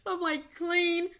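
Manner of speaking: laughing it out, high and loud; giggling protest. Real, not acted. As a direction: laugh through the words in disbelief. Laughing at accusation.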